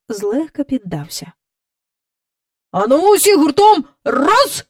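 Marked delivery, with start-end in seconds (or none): 2.7-4.6 loud, higher rallying call; rally everyone to heave; shouted call. Acted. Park keeper calls all to pull at once, loud shout. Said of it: "гукнув доглядач".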